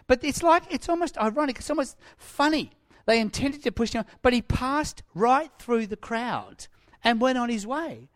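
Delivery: normal talking voice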